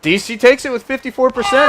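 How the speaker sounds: loud, high triumphant announcement; excited shout. Real, not acted. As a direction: shout the result like a winner. Host announces poll winner.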